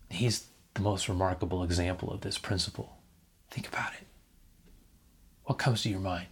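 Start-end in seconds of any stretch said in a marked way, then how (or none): 3.5-4.1 whispered. Real, not acted. Preacher draws listeners in close.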